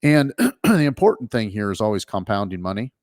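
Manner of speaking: usual speaking voice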